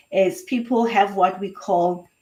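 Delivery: usual speaking voice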